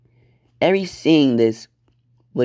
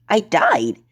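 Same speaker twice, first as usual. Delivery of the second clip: high-pitched disbelieving outburst; incredulous; 8 semitones higher than usual. Acted. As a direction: blurt it out, voice jumping up. Eri reacts incredulously.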